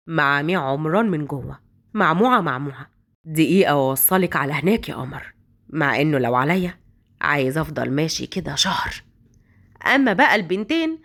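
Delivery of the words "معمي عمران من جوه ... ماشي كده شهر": low male voice. Acted. Omran to Hagar while driving, low and smitten. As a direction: linger over it like you never want the drive to end.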